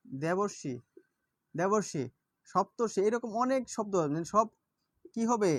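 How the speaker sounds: normal talking voice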